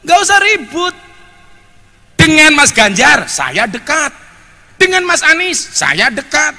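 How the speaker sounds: high-pitched oratory in punchy parallel phrases; rousing preaching. Real, not acted. Preacher assuring the crowd he backs no candidate, rousing preaching.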